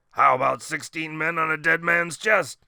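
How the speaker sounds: loud, booming sea captain roar. A narrator's reading. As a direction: bellow it like an old pirate. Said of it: "he roared".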